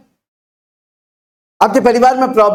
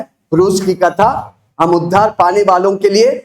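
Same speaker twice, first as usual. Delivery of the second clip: ordinary delivery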